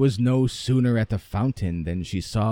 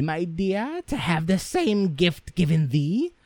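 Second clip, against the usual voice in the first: put-on higher, coaxing old mother; pitched-up mother voice, sweetly pushing; mother's voice; 6 semitones higher than usual. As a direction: wheedle like a doting, greedy mother. Mother urges her favoured daughter.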